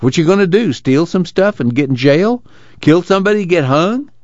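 high-pitched, incredulous farm worker; challenging Willie. Acted. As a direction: fire challenging questions, pitch climbing high.

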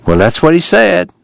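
reluctant preacher passing on bad news; hesitant preacher. A narrator's reading. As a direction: answer awkwardly, pitch climbing at the end. Preacher answers sick woman awkwardly.

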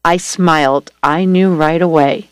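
usual speaking voice

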